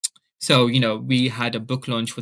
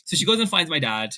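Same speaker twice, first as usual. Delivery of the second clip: ordinary talk, nothing marked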